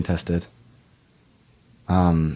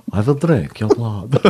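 put-on slow Transylvanian vampire accent; badly faked Transylvanian count; Dracula; slower than usual. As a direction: draw it out like a hammy vampire. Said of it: "Bram Stoker's Dracula".

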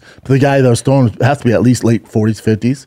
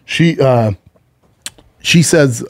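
ordinary talk

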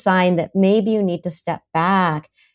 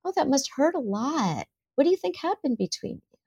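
higher and much softer, caring; tender sympathy; 7 semitones higher, 6 dB quieter than usual. Shown as a demonstration. Parent comforting a hurt child, tender and soft. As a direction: ask gently, like holding a hurt child. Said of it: "as opposed to".

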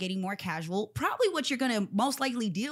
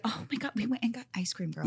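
whispered gushing; 2 semitones higher than usual. Retold or imitated.

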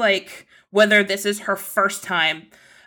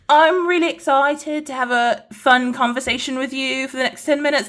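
higher-pitched, badly faked British accent; terrible British accent; 4 semitones higher than usual. Interviewer imitating the charming British actor, terrible British accent. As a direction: put on a shaky attempt at British. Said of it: "That's a terrible British accent".

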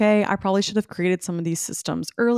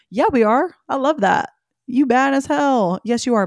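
higher, louder cheering herself on; sassy hype; 5 semitones higher, 6 dB louder than usual. Pretend. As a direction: hype yourself up like a best friend.

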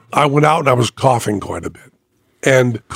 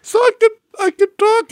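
much higher, thin falsetto question; squeaky broken voice; faster than usual. Retold or imitated. Imitating his broken voice.

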